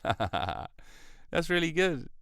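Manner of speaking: laugh, then savour it slowly; genuinely tickled, pitch lifted; real amusement. Real, not acted. Amused by the fact just read.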